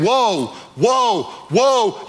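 Whoa, whoa, whoa as a lightly voiced alarmed eagle cry. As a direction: sound the eagle's alarmed warning. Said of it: "afraid of the eagle saying".

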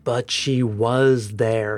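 slow, low anguished groan. A narrator's reading. Haunted captain insisting to the doctor, slow groan. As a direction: drag the words out in pain. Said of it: "he groaned to himself".

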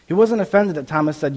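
normal talking voice

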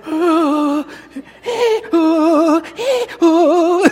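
imitating a kid's high panicked wails; loud, very high mock whimpering; panicked child's wail. Preacher mimics his son seeing the blood, panicked. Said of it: "then he saw the blood".

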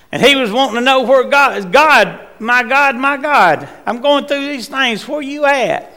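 high, loud, pleading call to God; preacher voicing David's desperate plea to God; pleading cry. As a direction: beg God for an answer in distress.

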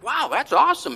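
a touch of happy surprise; delighted reply. Retold or imitated. Dad replying to son's cheer.

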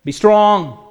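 God commanding his weary people to keep building, ringing out. Acted. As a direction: proclaim it as God urging them on. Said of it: "the word of the Lord comes to them and says".

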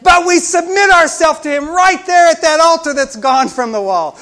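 high, very loud pulpit proclamation; full-force preaching. Acted. Preacher telling the congregation God loves them, at full force. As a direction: drive it out loudly on a high, sustained pitch.

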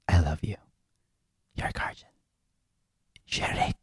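low and hushed, fading to a whisper; dramatic soft sign-off. Acted. Husband closing his love letter to his wife, dramatically hushed. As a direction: let the closing words fade into a whisper. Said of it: "like, a dramatic voice?".

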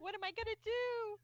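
high mock lament; mock whine. Car owner lamenting her crashed car, high mock whine. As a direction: whine over the wrecked car. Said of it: "She's like".

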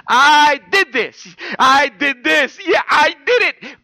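shouted triumphant declaration; triumphant cry. Acted. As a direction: cry it out like a victor at the finish. Pastor voicing Paul triumphantly.

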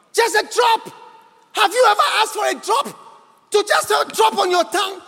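high, urgent preacher's questioning; impassioned preaching. Real, not acted. Preacher warning the church about hell, impassioned high preaching. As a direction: drive each phrase home with pauses between.

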